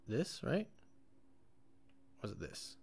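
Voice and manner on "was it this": whispered. Real, not acted. Muttering to self.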